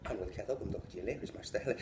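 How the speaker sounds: rattled-off Irish sentence; fast Irish phrase. Retold or imitated. Teacher making the boy say it in Irish, fast. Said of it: "you have to say".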